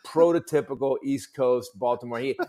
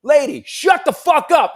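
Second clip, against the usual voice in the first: angry heckler; 12 semitones higher, 7 dB louder than usual. Retold or imitated. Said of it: "He goes".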